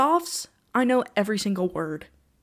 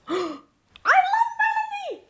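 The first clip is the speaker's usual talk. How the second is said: excited gasp; much faster than usual. Real, not acted. Gasping about favorite artist.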